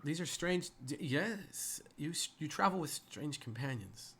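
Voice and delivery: put-on flustered, halting young guard; stuttering. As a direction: break off words and restart them. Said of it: "if you'd stop stuttering".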